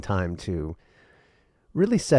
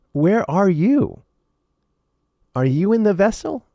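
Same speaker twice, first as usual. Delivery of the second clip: emphatic challenge; 10 semitones higher, 5 dB louder than usual. Real, not acted. Rhetorical challenge to listeners.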